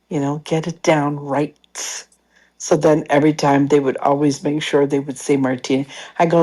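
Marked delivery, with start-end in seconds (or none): none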